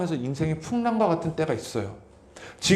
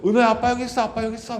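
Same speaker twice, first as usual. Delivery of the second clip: calling to his son; 7 semitones higher, faster than usual. Retold or imitated. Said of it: "막 뛰어갔어요".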